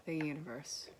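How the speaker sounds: usual speaking voice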